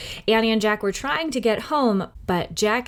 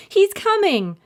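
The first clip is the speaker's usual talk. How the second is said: put-on much higher, louder little-girl call; Annie shouting; 12 semitones higher, 4 dB louder than usual. A narrator's reading. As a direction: call down high from the treehouse. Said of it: "shouted Annie".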